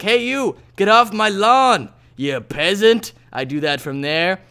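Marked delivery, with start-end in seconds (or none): 0.0-3.1 grumpy shout. Retold or imitated. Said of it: "go shout things like".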